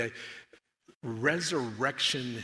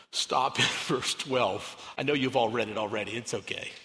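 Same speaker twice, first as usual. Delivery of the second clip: ordinary delivery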